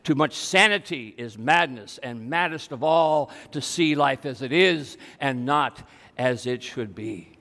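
a hint of Don Quixote, grave and slow. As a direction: Don Quixote answers the world that calls him mad, low and measured. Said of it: "he says".

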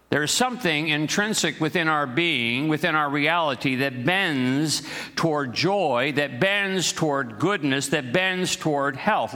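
high, stretched-out refrain building in threes; slow, lifted sermon cadence; emphatic preaching. Real, not acted. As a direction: draw out each "bends toward" phrase.

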